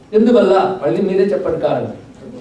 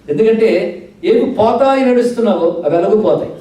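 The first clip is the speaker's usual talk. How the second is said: usual speaking voice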